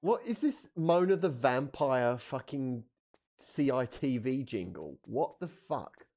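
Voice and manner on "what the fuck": normal talking voice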